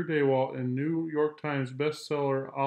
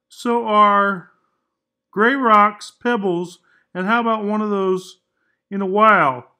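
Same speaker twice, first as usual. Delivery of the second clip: louder, higher, exhausted complaining crayon; worn-out Gray Crayon; 7 semitones higher, 12 dB louder than usual. Acted. Gray Crayon complaining to Duncan, loud and worn out. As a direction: moan about the huge animals, worn out and loud.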